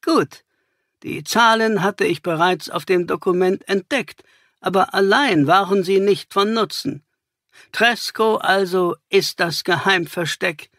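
scorn the dead accomplice; cynical villain. Acted. Villain keeps talking. (zynisch, höher und spöttisch)

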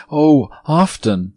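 put-on bright little girl voice answering eagerly; little girl answering the ghost eagerly; eager cry. A narrator's reading. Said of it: "cried the little girl".

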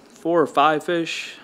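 usual speaking voice

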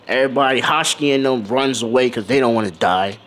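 usual speaking voice

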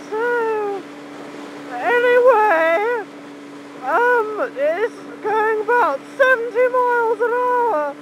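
high pitched. Real, not acted. Rider talking to viewers over wind noise, high pitched. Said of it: "going quite high pitched today with my voice".

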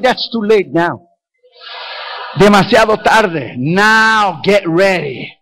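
slow, drawn-out verdict; mock doom taunt. Preacher answering the panicking passenger, drawn-out mock doom. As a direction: stretch each word in gloating mock solemnity.

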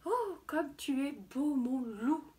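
slow, lightly voiced adoring she-wolf; admiring she-wolves. A narrator's reading. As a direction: swoon slowly, as if into the wolf's ear. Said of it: "en murmurant à ses oreilles". (louves admiratives, voix légèrement jouée)

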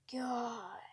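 ordinary delivery

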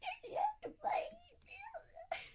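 giggly whispered quote; laughing whisper. Retold or imitated. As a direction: laugh through the words. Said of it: "I was like".

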